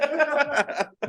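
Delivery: a high, breathy burst of laughter. Real, not acted. Teasing friends over tiny measures, high laugh.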